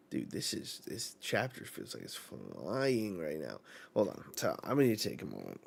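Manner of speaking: soft near-whisper aside; hushed aside. Real, not acted. Reacting to the viewers, a soft whispered aside. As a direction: lean in and whisper it.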